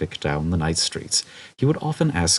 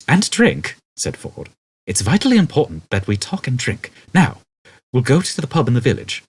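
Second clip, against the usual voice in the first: higher, louder insistence on vitally; Ford insisting; 6 semitones higher, 4 dB louder than usual. A narrator's reading. Ford insisting to Arthur they talk and drink, urgent.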